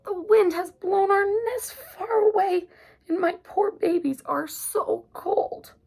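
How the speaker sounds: high, slow squeaky mother mouse; high squeaky mouse voice, slow and fretful. A narrator's reading. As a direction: squeak it out like a worried little mouse. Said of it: "squeaked Mother Mouse".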